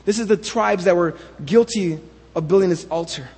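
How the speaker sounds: ordinary delivery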